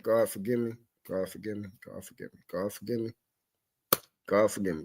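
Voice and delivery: low, fading mutter, repeated under the breath; a real plea dropping to almost nothing; muttered prayer. Real, not acted. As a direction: ask God for pardon to yourself. Repeated muttered plea after swearing.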